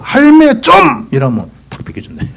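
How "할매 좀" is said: loud, high, slow drawn Busan 할매 좀; Busan dialect. Retold or imitated. Preacher showing the three-syllable Busan request to grandma, loud Busan call. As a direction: call it out in broad Gyeongsang dialect. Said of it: "딱 석자".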